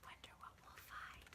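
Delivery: faint whisper of wonder; hushed anticipation. Real, not acted. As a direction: barely breathe it.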